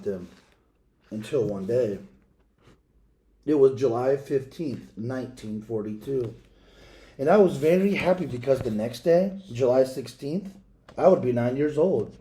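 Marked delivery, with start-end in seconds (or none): none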